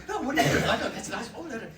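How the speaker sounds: high, bright, laughing reassurance; chirpy cheerleader. Retold or imitated. Actor cheering up a grumpy night-shoot crew, high and laughing. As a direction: cheer everyone up, laughing as you go. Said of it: "I'd be like, I'd say".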